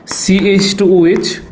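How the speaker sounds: CH টু OH very slowly, each part drawn out, টু stretched with a rise; slow dictation. Shown as a demonstration. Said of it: "teacher dictates the formula slowly". (ধীরে ধীরে বলা CH টু OH)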